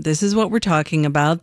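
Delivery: normal talking voice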